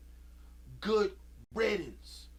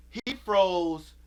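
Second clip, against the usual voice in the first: normal talking voice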